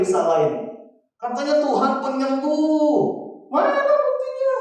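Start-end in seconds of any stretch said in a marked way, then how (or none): 1.2-4.6 high, sneering challenge, rising sharply on the question; mocking doubter. Churchgoer mocking God for an unanswered healing prayer, high and sneering. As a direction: scoff at the healer who failed you.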